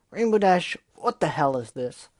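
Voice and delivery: loud, sharp and high on the name; angry shout. Acted. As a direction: confront her friend, furious. Gilda angrily confronts Dash.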